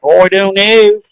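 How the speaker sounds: attempted Australian with twisted vowels; amateur, hammed-up Australian accent. Shown as a demonstration. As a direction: bend "know" into a mock Australian vowel. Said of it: "I can do Australian accent almost".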